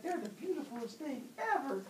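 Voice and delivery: high, gushing mother's voice; doting mother. Retold or imitated. Mother Earth cooing over her hundred-handed babies, high and doting. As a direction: coo over the newborns.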